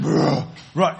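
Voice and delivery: loud rumbling mock growl; bear growl. Pastor scares the campers from the bushes with a bear growl. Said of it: "I'm just like".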